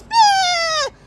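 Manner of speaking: high mock baby wail; baby cry. Another baby on the bus crying, high mock wail.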